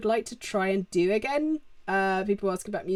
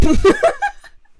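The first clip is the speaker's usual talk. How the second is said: high burst of laughter; 12 dB louder than usual. Real, not acted. Laughs about Merylwen.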